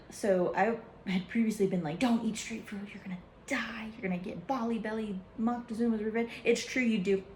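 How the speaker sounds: usual speaking voice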